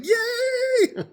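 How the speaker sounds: a high, loud squeal of joy; mock cheer. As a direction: cheer the fight on gleefully. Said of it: "And I was like".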